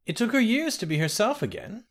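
a hint of a kindly old woman; old nursemaid. Ramke voiced old nursemaid.

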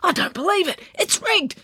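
high-pitched outraged bystanders; shocked, high-voiced protest; gabbling crowd outcry. A narrator's reading. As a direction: cry out in disbelief from the crowd. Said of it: "explosion of gabbling and conversation".

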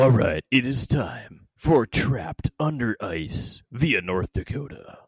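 slow, booming radio announcer; news voice. Shown as a demonstration. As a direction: announce it like a newscaster. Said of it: "news voice".

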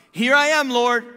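high, loud mock-willing answer; eager volunteering. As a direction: sing out as if ready to serve. Moses's hearty answer before refusing.

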